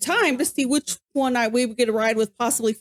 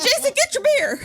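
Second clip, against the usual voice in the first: urgent call; 11 semitones higher than usual. Retold or imitated. Host reminding drunk friend of his beer, higher urgent call. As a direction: snap it out to the stumbling friend. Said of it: "I'm like".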